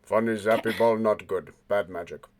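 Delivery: low, flat broken speech; low creature voice. Acted. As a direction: speak like a dim creature. Haji Baji creature voice.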